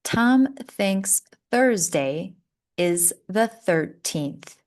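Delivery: slow model reading. Shown as a demonstration. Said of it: "Repeat after me".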